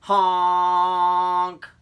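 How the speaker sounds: long loud high honk; loud drawn-out honk; moose honk. Acted. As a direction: blast a honk like a foghorn. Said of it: "and he honked".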